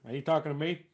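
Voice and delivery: understated movie tough guy, quietly challenging; Robert De Niro. Shown as a demonstration. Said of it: "if I was doing Robert De Niro".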